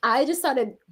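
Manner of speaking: usual speaking voice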